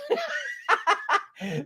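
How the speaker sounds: soft, high giggle. Real, not acted. Giggling after joking about expensive zip codes. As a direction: let a high little laugh slip out.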